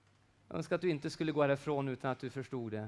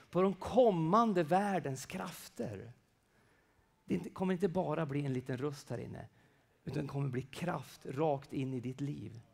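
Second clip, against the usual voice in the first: ordinary delivery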